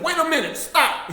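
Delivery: high, loud order to stop; loud stern command. Retold or imitated. To the misbehaving child, a loud high command. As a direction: shout the child down.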